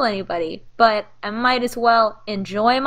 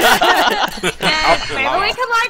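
giggling through the words; laughing while talking; 5 semitones higher, 4 dB louder than usual. Acted. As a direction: laugh while voicing the girl. Laughing imitation of the girl.

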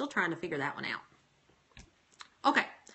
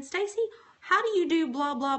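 a playful, high-pitched imitation of people asking; mock fan question; 9 semitones higher, 5 dB louder than usual. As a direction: squeak out the question like an eager follower. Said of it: "people are always saying".

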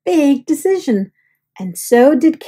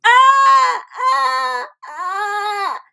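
frightened yells; 10 semitones higher, much slower than usual. Acted. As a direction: cry out three times in alarm. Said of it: "yelled Carrie".

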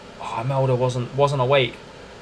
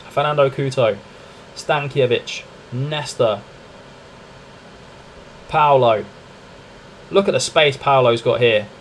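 ordinary talk, nothing marked

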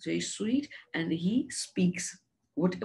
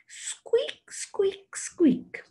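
high-pitched little squeaks; hamster squeak; 13 semitones higher, 3 dB louder, much slower than usual. Acted. Teacher showing children what hamsters say, high squeaking imitation. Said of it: "And they say".

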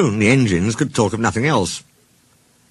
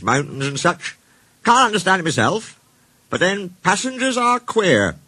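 higher-pitched little-engine voice; small engine Bert, chatty; 9 semitones higher than usual. Acted. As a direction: explain in a high, small character voice.

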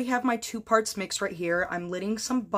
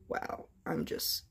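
awed whisper; 5 semitones lower, 9 dB quieter, slower than usual. Real, not acted. Awed whisper at own work.

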